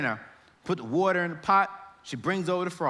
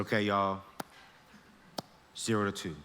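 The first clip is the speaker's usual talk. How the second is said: softer, lower calm call; quiet instruction; 10 semitones lower, 7 dB quieter than usual. Retold or imitated. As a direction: murmur the step to the class. Teacher repeats instruction softly.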